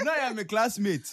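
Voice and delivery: laughing. Real, not acted. Laughs about his classmate.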